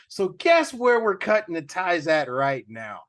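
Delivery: slow, firm warning; stern boss. Retold or imitated. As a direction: lay down the law slowly. Retold firing, rising anger.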